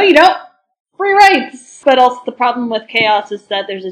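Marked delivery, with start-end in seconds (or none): 0.0-1.8 loud, rising rebellious cry; defiant shout. Acted. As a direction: yell it like a rebel. Chaos side shouting back.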